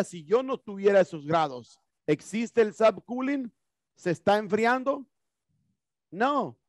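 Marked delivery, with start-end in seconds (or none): none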